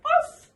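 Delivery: high loud yappy bark; dog bark. Shown as a demonstration. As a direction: yap like a little dog. Imitating a dog for child.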